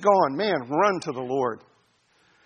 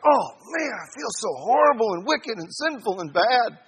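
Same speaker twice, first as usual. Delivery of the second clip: higher, put-on whimpering voice; mock despair; 6 semitones higher than usual. Preacher playing the crushed believer answering the Spirit, mock despair. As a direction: moan it in hammed-up self-pity. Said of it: "And you're just like".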